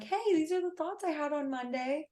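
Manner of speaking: high, chirpy greeting; light mock of a cheery marketer; mock upbeat host. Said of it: "being like".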